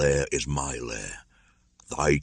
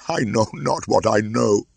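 put-on much higher, louder, tearful boy; sobbing cry; 8 semitones higher, 5 dB louder than usual. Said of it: "throwing up his arms with a sob".

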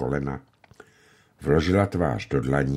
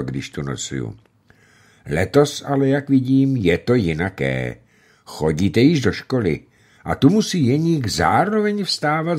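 louder, raised, genial forester voice; hearty hunter; 5 semitones higher, 5 dB louder than usual. A narrator's reading. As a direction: boom it out like a proud father. Hunter Bayer chats with children.

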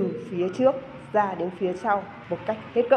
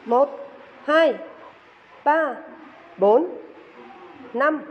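counting workout reps; 3 semitones higher, much slower than usual. Shown as a demonstration. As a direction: call each beat like a fitness coach. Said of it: "Chúng ta cùng thực hiện lại nhé".

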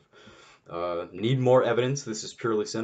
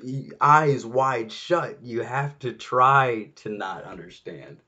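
heated, loud and higher rant; angry rant; 4 semitones higher, 6 dB louder than usual. Real, not acted. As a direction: vent the outrage at full volume. Ranting about US motives.

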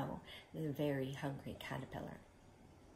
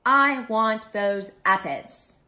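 loud, slow, grouchy demand; grumpy bug laying down a claim; 4 semitones higher, 15 dB louder, slower than usual. Acted. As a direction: punch out each word greedily. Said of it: "shouted the grouchy ladybug".

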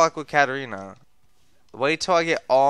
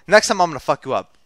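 ordinary talk

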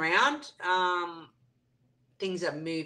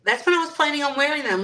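normal talking voice

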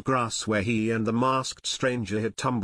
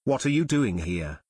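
ordinary delivery, nothing marked

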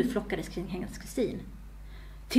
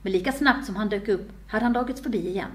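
usual speaking voice

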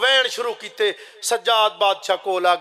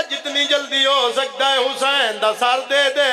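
full-force preaching; 4 semitones higher than usual. Acted. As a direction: drive the majlis to its peak. (ਪੂਰੇ ਜੋਸ਼ ਨਾਲ ਜ਼ਾਕਰੀ)

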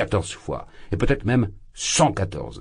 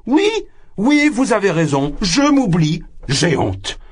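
ashamed outburst; 12 semitones higher, 5 dB louder than usual. Acted. Drunk man caught out, exclaims.

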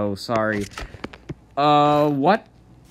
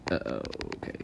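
breathy whispered uh-oh; whispered dread; 11 semitones lower, 11 dB quieter, much faster than usual. Real, not acted. Whispered dread during a fight.

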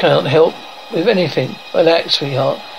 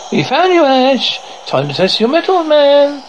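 much higher put-on voice; high-pitched voice; 10 semitones higher than usual. A narrator's reading. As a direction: pitch it way up, taunting Ash. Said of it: "high-pitched voice".

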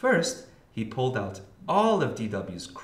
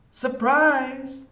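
high, loud surprise shout; spring it on them with a big cry; excited cry; 8 dB louder than usual. A narrator's reading. D W waiting in the treehouse, excited cry. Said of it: "D W cried".

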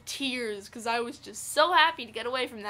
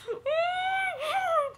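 mock horrified shriek. Vlogger acting out a horrified scream, mock shriek. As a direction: let out a fake screaming sob. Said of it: "I wasn't actually doing that".

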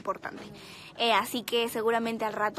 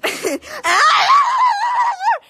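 loud, high burst of laughter; shrieking laugh; 12 dB louder than usual. Real, not acted. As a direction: crack up, shrieking. (carcajada aguda y fuerte)

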